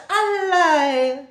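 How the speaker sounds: high, loud Jamaican disbelief; shocked exclamation. Shown as a demonstration. Reacting to gossip, loud shocked patois. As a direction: cry out "A lie!" in shocked disbelief. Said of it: "this is usually an expression".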